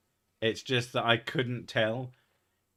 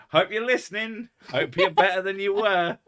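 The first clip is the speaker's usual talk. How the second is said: mock taunting call; 8 semitones higher, 6 dB louder than usual. Teasing the family if listening.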